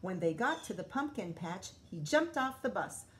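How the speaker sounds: usual speaking voice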